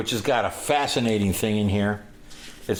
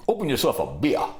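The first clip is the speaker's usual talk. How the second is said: higher, swooping bluesman impression; 6 semitones higher than usual. Musician to his young drinking companion, imitated command. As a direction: imitate the musician handing down an order. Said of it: "and he goes".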